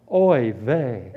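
low, resigned lament; lightly acted groan of dismay. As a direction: sink down into a mock lament.

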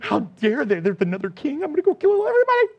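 a jealous Herod spiraling into panic; a lightly voiced mock Herod tantrum; paranoid king raging. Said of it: "He imagined the fight".

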